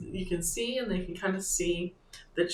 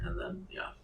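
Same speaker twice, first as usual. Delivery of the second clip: whispering; 11 dB quieter than usual. Real, not acted. Muttering aside to self.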